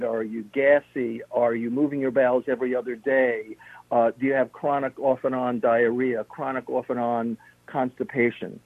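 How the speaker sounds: ordinary talk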